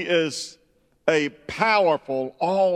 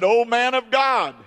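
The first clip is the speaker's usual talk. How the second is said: high pleading cry of "Oh, man of God"; pleading widow; 7 semitones higher, 5 dB louder than usual. Retold or imitated. Widow voiced in sermon story.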